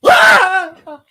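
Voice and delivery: sudden high scream of fright; startled scream. Real, not acted. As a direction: jump and scream, caught off guard. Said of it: "Don, you scared me".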